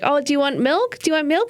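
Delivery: put-on high, sing-out offer to a child; coaxing babysitter. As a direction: pitch it up as if asking a toddler.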